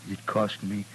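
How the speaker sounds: ordinary talk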